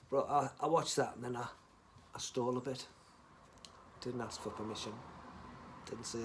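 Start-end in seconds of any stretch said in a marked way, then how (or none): none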